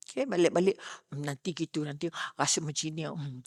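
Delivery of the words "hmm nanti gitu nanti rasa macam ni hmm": much softer hesitant musing; second-guess yourself under your breath; doubtful inner muttering. Retold or imitated. Preacher voicing the listeners' doubts, soft hesitant musing.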